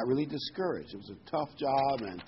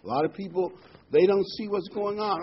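ordinary delivery

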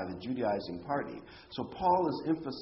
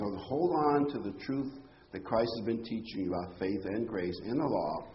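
usual speaking voice

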